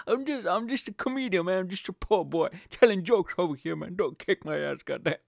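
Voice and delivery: mock pleading. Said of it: "he's gonna kick my ass".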